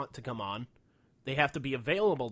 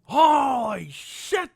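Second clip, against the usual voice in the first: slow, much higher, loud "Holy shit!"; shouted disbelief; 11 semitones higher, 11 dB louder, much slower than usual. Real, not acted. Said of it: "repeated louder exclamation".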